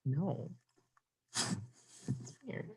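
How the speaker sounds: ordinary talk, nothing marked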